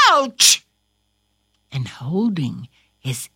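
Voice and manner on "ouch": short, lightly acted yelp of pain; mild yell. Caleb cut by the thief, yelling mildly. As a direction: cry out as if suddenly cut. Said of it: "He yelled the word".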